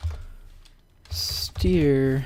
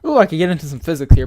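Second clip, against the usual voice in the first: excited; 2 semitones higher, 11 dB louder, much faster than usual. Real, not acted. Sudden nerdy enthusiasm.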